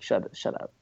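the Joker head whispering shut up; Shut, shut up whispered as the Joker head. Retold or imitated. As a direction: whisper it like a grumpy severed head. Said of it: "And the Joker head is like".

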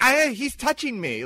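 high, loud, drawn-out mock complaint; wife calling out. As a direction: cry out as an outraged wife, hammed up.

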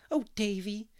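a lightly prim little scold; primly. Acted. Dora primly scolds her brother, a hint of prim. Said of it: "said Dora primly".

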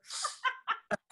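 very high squeal of laughter. Real, not acted. Retelling the ex showing up, squealing laugh.

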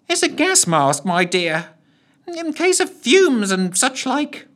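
put-on high, slow fussy old gent; old gentleman. A narrator's reading. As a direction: explain kindly like a doddery old gentleman. Voices Lushthorpe.

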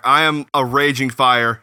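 quoting Thor. Retold or imitated. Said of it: "I'm gonna quote, uh, Thor Ragnarok".

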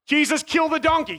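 high, pleading mock prayer; desperate plea. The donkey sizing him up, desperate prayer. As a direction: beg heaven to strike the donkey.

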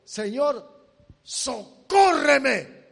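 loud, slow cry for help; desperate cry. Acted. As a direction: stretch out socórreme as a begging shout. La mujer postrada ruega. (grito suplicante y lento)

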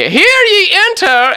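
loud, very high, drawn-out call; hammed-up captain bellowing a summons; booming summons. Captain calls the visitors into his office, hammed-up high bellow.

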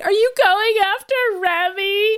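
high-pitched mock plea; Lorna. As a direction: imitate an upset daughter, voice pushed up. Acting out Lorna's plea to father.

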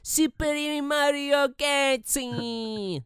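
"Galaxy" squashed to "Gaxy", high and slow; a dad mimicking his son's baby-talk "Gaxy"; kid mispronunciation. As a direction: say "Super Mario Galaxy" the way a toddler would, "Gaxy". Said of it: "he doesn't say galaxy. He says Gaxy".

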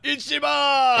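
high, drawn-out mock yell; imitated wrestler's shout. Co-host imitating a wrestler shouting his catchphrase, drawn-out yell. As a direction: holler it high and stretch it out. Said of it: "the number of times I he's shouting".

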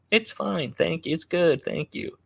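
high-pitched mock politeness; polite reassurance. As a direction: imitate diners politely brushing off the manager. Diners politely lying to manager.

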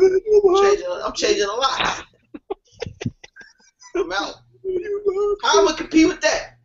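high, hammed-up wail; mock-crying protest, starting loud; mock distress. Acts man losing girl to Idris.

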